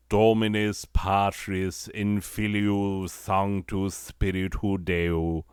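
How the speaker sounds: slow mock-liturgical Latin in a British accent. Mocking how people pronounce Latin, in a British accent. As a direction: intone Latin like a British actor. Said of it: "pronouncing Latin with a British accent".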